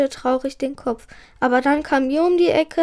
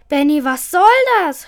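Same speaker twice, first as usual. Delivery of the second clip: a mild angry call, louder; shouting; 2 semitones higher, 5 dB louder than usual. A narrator's reading. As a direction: accuse him across the room. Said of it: "schrie Ida". (mild gespieltes Schreien)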